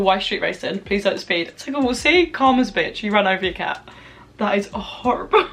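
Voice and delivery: ordinary talk, nothing marked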